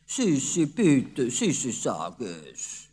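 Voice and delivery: pleading, the last words drawn out slowly; a hint of a begging father; coaxing. A narrator's reading. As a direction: wheedle it out of her.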